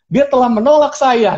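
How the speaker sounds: high-pitched mock accusation; indignant complaint. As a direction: declaim it like an outraged public post. Acted angry post about the refusal. (keluhan geram yang didramatisasi)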